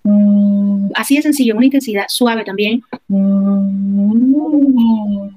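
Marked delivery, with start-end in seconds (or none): 0.0-0.9 an even, level hum through a straw; steady straw hum. Shown as a demonstration. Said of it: "simplemente generar la vibración". 3.1-5.3 a hum that climbs in pitch, then eases back; rising straw hum. Shown as a demonstration. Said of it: "voy subiendo la altura del sonido".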